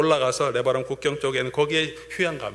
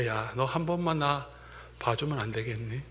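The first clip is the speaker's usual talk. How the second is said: tender plea; 4 semitones lower, 8 dB quieter than usual. Retold or imitated. Voicing the Lord's gentle plea.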